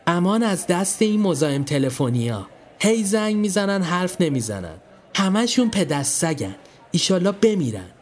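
said loudly for others to hear. Acted. Milad speaking loudly to Zari, meant for Marzieh's ears.

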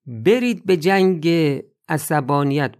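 rousing call. Real, not acted. Preacher urges fight against anger.

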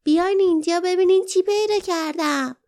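high, loud little creature voice calling over; a tiny creature excitedly calls the others over; small creature calling. A narrator's reading. Perrin calls companions to her find, high little creature voice. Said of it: "آنها را آرام صدا زد".

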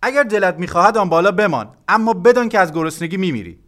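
loud, high shouted threat; father shouting. A narrator's reading. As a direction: bellow a warning out of the window. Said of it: "سر را از پنجره بیرون آورد و داد زد".

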